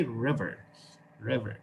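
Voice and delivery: river in an American accent, final R kept; American accent, keep the R at the end; American pronunciation. Shown as a demonstration. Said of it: "the Americans still have a little bit of the R".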